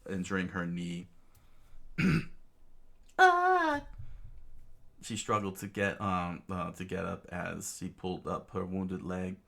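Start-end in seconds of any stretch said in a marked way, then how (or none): 3.2-3.8 high, loud yelp; sudden falsetto "Ah!" of pain; cry of pain. Acted. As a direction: yelp as if you hurt your knee. Princess hurts her knee falling.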